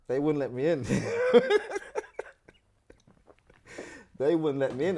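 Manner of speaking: says it again breaking into a high laugh. Real, not acted. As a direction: laugh through the words at the memory. Laughing at the memory.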